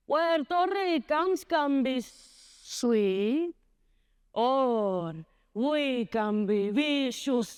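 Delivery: slow, stretched singsong delivery; singsong grandmother. Acted. Grandmother telling what Puerto Ricans are like, singsong. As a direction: draw the words out like a singing grandmother. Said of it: "My grandmother always sang".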